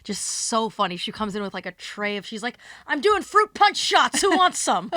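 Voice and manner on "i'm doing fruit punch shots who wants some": high, loud tipsy party call; much higher and louder, giddy and drunk; drunk party host. Retold or imitated. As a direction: shout it out like a buzzed hostess offering shots. Drunk host offering shots.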